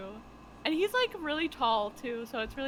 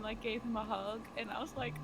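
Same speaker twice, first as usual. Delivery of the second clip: ordinary talk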